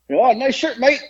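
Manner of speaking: high-pitched imitation British accent. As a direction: do a rough British woman's greeting. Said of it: "in her British accent".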